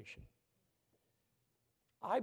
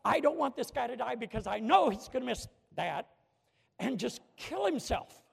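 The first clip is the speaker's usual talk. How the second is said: frightened inner scream; 5 semitones higher, 6 dB louder than usual. Retold or imitated. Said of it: "I'm screaming inside of myself".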